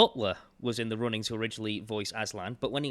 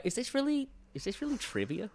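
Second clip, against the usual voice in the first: higher-pitched, doubtful self-questioning; incredulous thought; 12 semitones higher, 4 dB quieter than usual. Retold or imitated. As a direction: read your inner doubt aloud, pitched up. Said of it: "just thinking, like".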